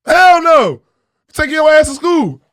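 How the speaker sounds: high, shouted scolding; imitated mother's loud, emphatic order; mom's loud refusal. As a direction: yell it like a mom shutting down a plea. Mom's retold refusal to child's plea.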